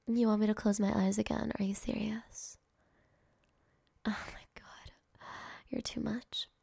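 ordinary talk, nothing marked

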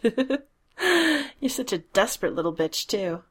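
slow giggly taunt; giggling. Acted. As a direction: giggle through the words. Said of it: "I giggle at your noises".

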